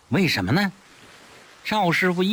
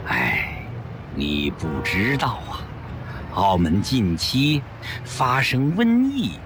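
sighing; 7 semitones lower than usual. A narrator's reading. Monk sighing to an old friend about the plague, weary. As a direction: sigh it out like a tired monk. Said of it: "宽灵法师叹了口气".

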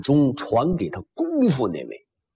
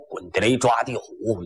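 usual speaking voice

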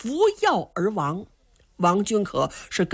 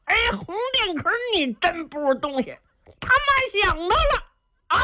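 put-on high, childlike dopey voice of the simple lad; simple-minded boy; 12 semitones higher than usual. Simple lad tells the squire he brought the stranger, high and childish. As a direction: play the naive giant talking like a child.

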